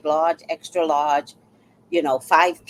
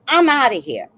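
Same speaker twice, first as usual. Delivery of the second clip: louder, higher retold announcement; loud exit line; 5 semitones higher, 6 dB louder than usual. Imitating Richard fleeing the quarrel, loud announcement. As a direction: call it out as a quick bail-out.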